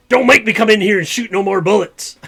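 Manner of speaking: sheriff warning. Acted. Sheriff warns the saloon crowd, loud stern warning. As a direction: bark the threat at the room.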